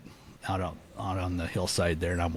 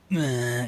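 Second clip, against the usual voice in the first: imitated wheezy elk bugle; droning growly elk call, drawn out; growly bull bugle; 5 semitones higher than usual. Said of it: "this big wheezy growly bugle".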